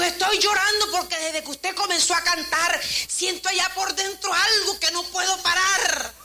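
high-pitched, tearful retelling of a crying man; imitated tearful voice. Crying man tells the singer what her singing stirred, mock tearful. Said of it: "¿Y usted, usted por qué está llorando?".

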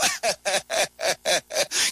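brief amused chuckle; short laugh. Real, not acted. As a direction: laugh at the foolish crowd. Laughs at the mockers. (risa breve divertida)

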